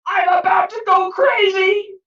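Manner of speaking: angry complaint. Acted. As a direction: vent your fury at the wise man. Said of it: "Peter was very angry".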